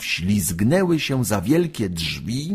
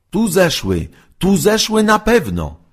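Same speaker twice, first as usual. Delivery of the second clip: higher, louder townsfolk calls; voices from above; 11 semitones higher, 5 dB louder than usual. A narrator's reading. Townsfolk call out above the cellar, higher and louder. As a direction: call down the stairs, certain. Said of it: "ozwały się głosy na górze".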